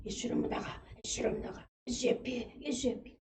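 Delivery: softly murmured "예수 이름으로 나가" like a sick man mumbling in his sleep; whispered mumbling prayer. Retold or imitated. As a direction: whisper the rebuke prayer under your breath. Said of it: "뭘 찌그렁찌그렁 해가지고". (잠결에 중얼거리는 속삭임 기도)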